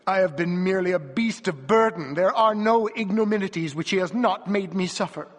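impassioned cry of misery; bitter lament. A narrator's reading. Wife laments her husband's cruelty.

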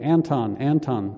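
ordinary delivery

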